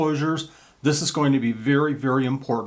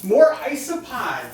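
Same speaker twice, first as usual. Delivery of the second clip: excited; 10 semitones higher, 3 dB louder, slower than usual. Acted. Gleeful reveal of new pets.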